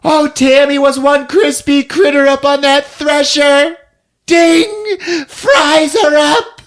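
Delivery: high-pitched mock screech; hammed-up unhinged yelling; hysterical rant. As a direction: crow it wildly at full pitch. Ranting confession, still yelling.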